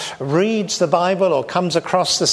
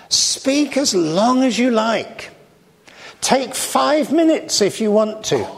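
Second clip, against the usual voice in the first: quoting the vicar; 6 semitones higher than usual. Retold or imitated. Vicar on the phone granting a short slot, lightly mimicked. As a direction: lightly play the vicar's offhand offer. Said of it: "with the immortal words".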